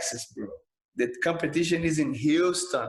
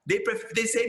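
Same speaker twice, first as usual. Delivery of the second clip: ordinary delivery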